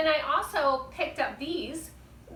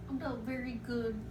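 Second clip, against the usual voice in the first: small, soft and sad puppet voice, quieter than the host; a sad little puppet, murmured softly; 6 dB quieter than usual. Acted. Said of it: "You're, you're sad?".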